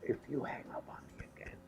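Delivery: Miles's whispery voice. Retold or imitated. Miles calling at 2:30 am, whispered and hoarse. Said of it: "he said".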